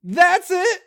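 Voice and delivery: very high, loud ecstatic cry; ecstatic shout. Acted. Climax cry, far louder.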